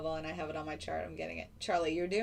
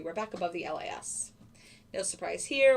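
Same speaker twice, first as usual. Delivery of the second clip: normal talking voice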